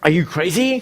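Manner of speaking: high, rising incredulous CRAZY; incredulous question. Shown as a demonstration. Teacher capping the wrongly questioned shopping list, incredulous question. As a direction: challenge them, pitched high up to CRAZY.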